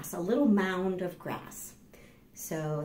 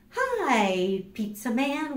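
cheerful greeting; 6 semitones higher, 3 dB louder, slower than usual. Shown as a demonstration. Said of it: "how do you say hello?".

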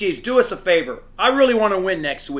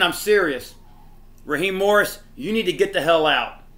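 usual speaking voice

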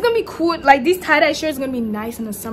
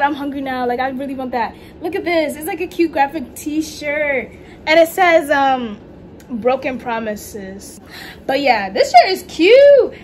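ordinary delivery

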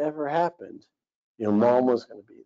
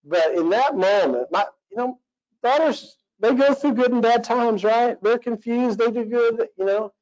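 higher pitched, pleading tone; raised emotional voice; 10 semitones higher than usual. Real, not acted. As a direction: let the voice climb high with feeling. High voice on daughters' struggles.

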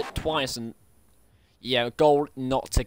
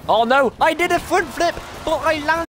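much higher, loud, thrilled shriek; excited yelp; 12 semitones higher, 5 dB louder than usual. Real, not acted. Driver thrilled his car flipped and landed, high excited yelp. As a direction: shout it out in disbelief at the flip. Car front-flips and lands.

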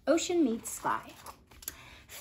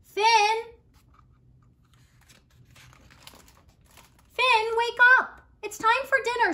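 high, loud call; mother calling Finn in for dinner; calling from afar; 11 semitones higher, 8 dB louder than usual. Acted. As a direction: call him from far away to wake up. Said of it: "a voice calling to him from far away".

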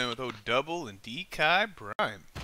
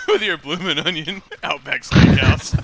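laughing; 2 semitones higher, 11 dB louder than usual. Real, not acted. Laughing at friend's Aussie joke.

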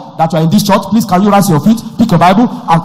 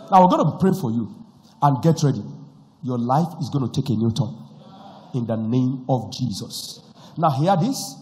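ordinary delivery

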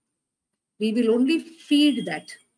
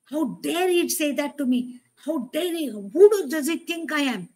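higher, mock-outraged "how dare he"; indignant inner voice; 5 semitones higher, 4 dB louder than usual. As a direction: play the offended inner voice. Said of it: "Now, how will it say?".

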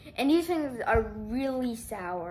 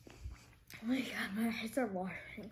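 whispering; 8 dB quieter than usual. Real, not acted. Overwhelmed by sour candy.

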